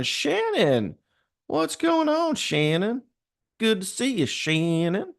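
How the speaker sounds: lightly drawled put-on accent, pitched high; a playful made-up accent greeting a viewer. Greeting Shannon in chat with a silly put-on accent. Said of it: "I don't know why I'm making that accent".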